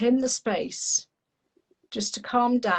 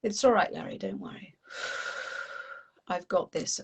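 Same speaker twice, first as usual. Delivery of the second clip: reassuring; 2 semitones lower, 5 dB quieter than usual. Acted. Child soothing panicking Larry the lizard, softly reassuring. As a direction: settle him down, dropping almost to a whisper. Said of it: "And he's panicking. And I go".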